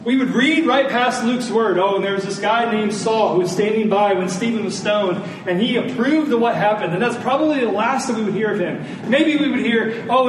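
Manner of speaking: usual speaking voice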